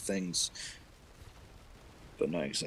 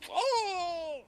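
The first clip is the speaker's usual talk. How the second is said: long, very high comic wail; hammed-up drawn-out yelp; mock scream of pain; 4 dB louder than usual. Cain getting the mark, mock pained scream.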